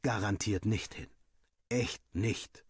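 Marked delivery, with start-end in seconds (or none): none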